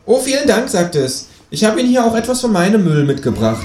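high, cheeky kangaroo; sweetly mock-polite in a high voice; high voice, faux-gracious handover. Kangaroo cheeky to ticket boy.

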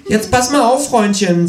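high, scolding kangaroo voice. Acted. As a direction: warn him in a high voice. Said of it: "sagt das Känguru im Fernsehen".

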